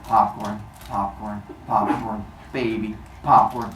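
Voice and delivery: low, soft popcorn chant; low, idle mumbling between bites; absent-minded munching Cronus. Acted. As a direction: drone it like a distracted TV watcher. Cronus absently eating while watching TV.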